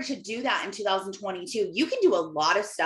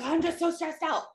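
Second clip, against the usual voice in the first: mock whiny complaint; 8 semitones higher than usual. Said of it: "Stop being overwhelmed and crying".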